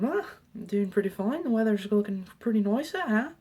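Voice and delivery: high, loud attempt at an Irish accent; terrible Irish accent. Student answers the janitor's greeting, terrible Irish accent. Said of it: "That's probably a terrible accent".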